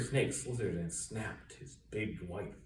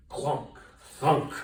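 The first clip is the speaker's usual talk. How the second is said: heavy thud words; 3 semitones higher, 7 dB louder than usual. A narrator's reading. Boot sound words.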